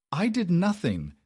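high, loud cry with a cracking voice; a tearful sister protests her innocence to her furious brother. A narrator's reading. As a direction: plead desperately as the voice breaks. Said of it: "Beatrice cried, her voice cracking".

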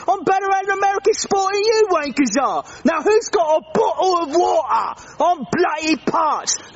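put-on gloating British accent; boasting British accent. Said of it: "I don't like his accent".

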